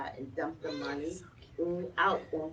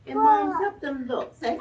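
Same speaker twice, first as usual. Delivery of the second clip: excited; 9 semitones higher, 8 dB louder than usual. Real, not acted. Addressing parents enthusiastically.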